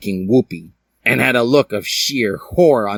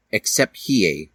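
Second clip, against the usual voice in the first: plain reading voice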